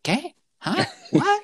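very high-pitched baffled questions; mock bafflement. Native speakers failing to understand a mumbling supervisor, mock baffled. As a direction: imitate listeners who can't make out a word.

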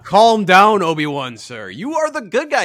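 loud, laughing 'calm down'; laughing mock scold. Real, not acted. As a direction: scold him through a laugh. Scolding Obi-Wan while laughing.

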